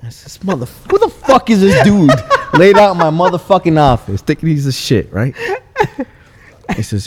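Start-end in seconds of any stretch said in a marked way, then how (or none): 0.3-5.3 loud, high, laughing mock outrage at the stranger; half-laughing, play up the indignation; offended outburst. Shop owner retelling his reaction to a stranger on his couch, loud laughing outrage. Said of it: "at first I was a little offended".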